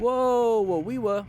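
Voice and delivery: high, loud startled cries; startled whoa. Real, not acted. Rider reacting to a sudden swerve, startled high cries. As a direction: caught off guard, real surprise.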